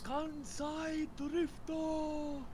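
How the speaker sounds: very high, drawn-out hype call; hyped shout. Real, not acted. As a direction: stretch every syllable like an announcer. Hyped call of a drift move.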